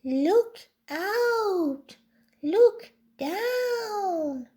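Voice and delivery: high, drawn-out calling voice; high-pitched storybook warning cry; yelled warning. A narrator's reading. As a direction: call it out across a distance. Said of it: "who yelled".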